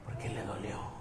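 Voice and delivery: hushed, breathy whisper; almost whisper the reason. Acted. Pastor revealing why Jesus wept, in a hushed whisper. Tender reveal after mock.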